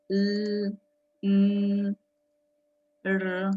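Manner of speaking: "L, n, r" hummed as isolated, sustained voiced sounds; teacher demonstrating "L, n, r" as sounds, not letter names; voiced sounds alone. Shown as a demonstration. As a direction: hold each of "L, n, r" with the throat vibrating. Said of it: "sí tienen sonido cuando los pronunciamos solos".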